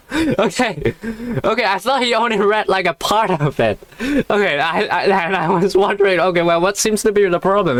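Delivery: high, giggly voice; amused, squeaky-high chatter; holding back laughter. Real, not acted. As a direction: talk while recovering from a laughing fit. Reader still giggling after the line.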